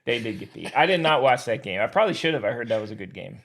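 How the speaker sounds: ordinary delivery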